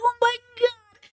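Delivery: high-pitched, drawn-out mock whimper; mock ugly crying. Retelling reaction to a wholesome story, mock tearful. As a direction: squeak it out as if overcome. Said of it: "I was ugly crying".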